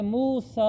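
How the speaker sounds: मूसा with a long-held final aa; elongated vowel. Shown as a demonstration. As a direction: stretch out the "saa" of मूसा. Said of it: "इसको खींचना है".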